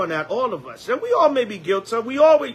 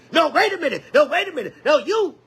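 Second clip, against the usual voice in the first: prideful pushback; 7 semitones higher than usual. Retold or imitated. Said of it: "now that pride gonna come in".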